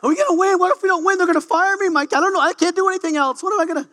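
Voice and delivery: high, frantic mock panic. Coach retelling his hallway panic before the match, high mock panic. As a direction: squeal it like a coach freaking out. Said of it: "Freaking out".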